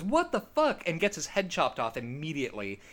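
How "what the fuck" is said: high, startled "what the fuck"; shocked outburst. Retold or imitated. Retelling the guillotine victim's startled high-pitched cry. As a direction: jump up in pitch in disbelief. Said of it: "and he goes".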